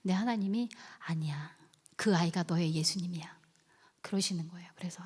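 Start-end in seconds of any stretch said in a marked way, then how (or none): 1.1-3.4 low, soft, hushed reply; imitated voice of God, lowered and soft; gentle divine answer. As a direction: quietly correct someone with tender assurance.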